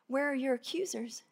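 soft, hushed question; tender quiet question; gentle. Retold or imitated. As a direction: ask her tenderly. Jesus gently asks woman.

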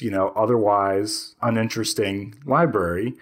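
usual speaking voice

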